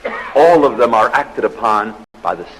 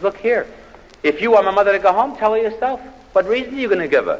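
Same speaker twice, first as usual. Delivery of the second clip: indignant wife; 12 semitones higher than usual. Retold or imitated. Wife snaps back at husband.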